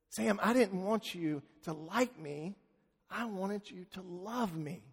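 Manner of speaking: father to his weeping son, tender and hushed; tender reply. Retold or imitated. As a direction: answer him gently, from the heart.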